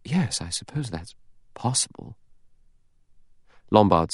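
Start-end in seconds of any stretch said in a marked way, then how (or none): 0.0-2.2 soft, higher-pitched reluctant agreement; slow, hesitant. Acted. Vera conceding Lombard's theory, slowly and softly. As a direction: say it softly, with pauses for thought. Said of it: "Vera said slowly".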